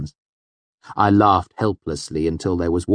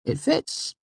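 higher, lightly surprised; mild surprise; 10 semitones higher, 3 dB quieter than usual. A narrator's reading. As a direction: remark it as a small discovery. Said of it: "I said, somewhat surprised".

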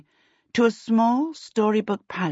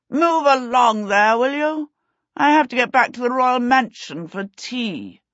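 high, loud royal command; haughty prince calling out crossly; impatient prince; 6 semitones higher, 4 dB louder than usual. A narrator's reading. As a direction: order servants along imperiously.

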